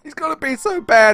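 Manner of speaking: hammed-up weepy fan breakdown; mock crying. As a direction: whimper it like a fake sob. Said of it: "And he starts crying".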